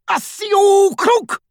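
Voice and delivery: high, drawn-out roared spell; Death Eater's roar. A narrator's reading. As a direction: roar the incantation, stretching it out. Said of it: "roared one of the Death Eaters".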